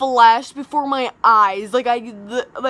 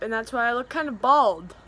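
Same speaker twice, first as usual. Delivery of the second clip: ordinary delivery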